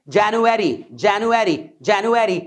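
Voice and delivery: January said "jan-yoo-eri", no v; January repeated. Shown as a demonstration. Teacher drills January for learners, clear and slow. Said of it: "व की sound को आप किल कर सकते हैं".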